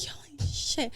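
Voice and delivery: breathy whispered "holy shit"; whispered shock. Retold or imitated. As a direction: whisper it in disbelief. Retold shock.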